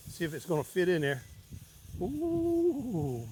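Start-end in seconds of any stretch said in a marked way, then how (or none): 2.0-3.3 high, soft, drawn-out ooh; long ooh. Real, not acted. Cook seeing the pot fill, long high ooh. Cook worried rice won't fit.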